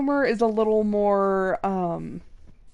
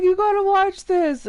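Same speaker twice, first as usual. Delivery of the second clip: louder, higher urging quote; husband's push; 8 semitones higher, 5 dB louder than usual. Retold or imitated. Husband urging wife to watch a video, insistent. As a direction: push it on her like an eager husband. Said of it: "He's like".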